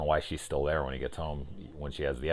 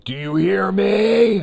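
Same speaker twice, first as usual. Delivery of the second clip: slow, high, loud call; booming call; 12 semitones higher, 9 dB louder, much slower than usual. Retold or imitated. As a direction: call out slowly across a crowd. Villain calls out in the movie.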